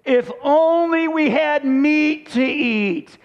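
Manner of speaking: high, slow, drawn-out moan of craving; mock complaining. Israelites craving meat after endless manna, mock complaining. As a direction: stretch it into a hammed-up complaint. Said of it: "began to complain and murmur again".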